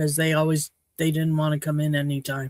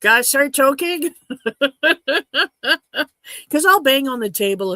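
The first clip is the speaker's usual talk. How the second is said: husband teasing; 8 semitones higher, 5 dB louder than usual. Retold or imitated. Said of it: "He says".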